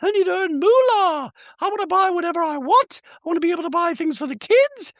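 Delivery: very high-pitched mock-chirpy caricature; ditzy reality-star send-up, far above normal pitch; Dorit. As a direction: squeal it out in a silly falsetto. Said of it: "She's telling her managers".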